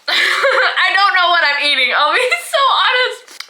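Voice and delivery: giggling through the words; laughing. Real, not acted. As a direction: laugh while you admit it. Laughing at own snack.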